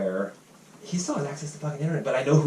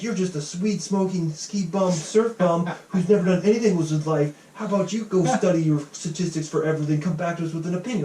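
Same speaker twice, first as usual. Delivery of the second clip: higher, louder sneering tirade, imitated; mock personal attack; 6 semitones higher, 3 dB louder than usual. Retelling the online critic's insult, mocking sneer. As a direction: parody a smug lecturer tearing into someone. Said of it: "he started attacking me personally".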